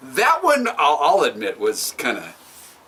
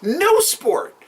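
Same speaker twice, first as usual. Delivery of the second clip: emphatic; 8 semitones higher, slower than usual. Real, not acted. Heated sports argument.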